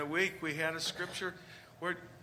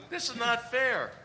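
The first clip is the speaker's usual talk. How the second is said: high, loud whine of complaint; whining workers; 6 semitones higher, 5 dB louder than usual. Retold or imitated. Workers complaining to the landowner, whiny and loud. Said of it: "They whined".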